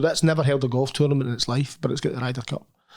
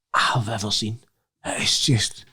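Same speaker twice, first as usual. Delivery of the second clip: awed whisper; 3 semitones lower, much slower than usual. Real, not acted. Awed praise of the course.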